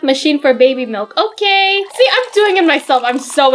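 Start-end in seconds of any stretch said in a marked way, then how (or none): 1.2-3.0 put-on proud toddler. Proud toddler doing it alone.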